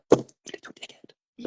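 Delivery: under the breath, whispered insult; whispered aside. Retold or imitated. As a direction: hiss it to yourself, amused. Dad's muttered reaction to son.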